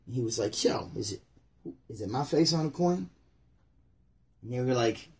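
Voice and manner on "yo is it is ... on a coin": smooth Jesus. Acted.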